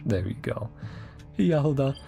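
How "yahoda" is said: normal talking voice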